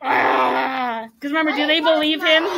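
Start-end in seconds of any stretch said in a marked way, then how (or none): 0.0-1.1 drawn-out mock cry; imitated wail. Teacher imitates Dominic's wordless cry.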